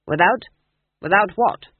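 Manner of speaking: loud, baffled, drenched soldier; confused soldier. A narrator's reading. Soaked soldier baffled by the porter, loud and confused. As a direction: blurt it out, lost and scared.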